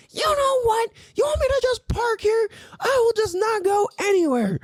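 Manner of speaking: very high mock-outraged squawk; exaggerated squeaky impression of an indignant customer; indignant woman. Line cutter threatening to park there, mock-indignant woman. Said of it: "then she was like".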